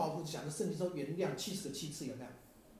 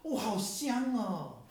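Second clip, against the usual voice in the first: slow, drawn-out, higher sigh of delight; savoring; 7 semitones higher, 5 dB louder, much slower than usual. Retold or imitated. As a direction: savor the smell as you say it. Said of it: "就讲这么一句话".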